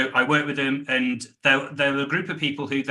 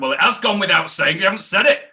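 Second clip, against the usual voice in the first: higher and louder, driving the point home; firm, slightly raised comeback to a complacent team; pointed retort; 7 semitones higher, 5 dB louder than usual. Retold or imitated. Retort to senior people in room.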